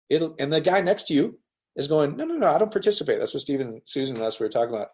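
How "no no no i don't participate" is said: higher, quick mock refusal; imitating someone opting out; dismissive refusal. Acting out neighbour refusing talk.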